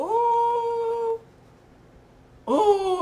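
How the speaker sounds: high, loud exclamation; a sudden startled ooh; surprised ooh. Real, not acted.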